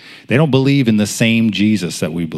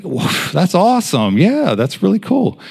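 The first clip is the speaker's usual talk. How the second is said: high-pitched approving reaction; delighted; 9 semitones higher than usual. Retold or imitated. Friend hearing of her baptism plans, delighted. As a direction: cheer the news on, pitch rising.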